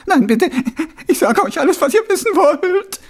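very high, loud wailing plea; cartoon crybaby. A narrator's reading. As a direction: beg in a blubbering wail. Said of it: "heulte der Mann".

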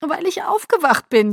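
lamenting, crying outburst; wailing. A narrator's reading. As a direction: wail it through tears. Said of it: "jammerte ich".

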